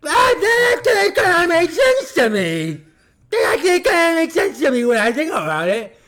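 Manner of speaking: ad voice. Acted. Ad skit convert.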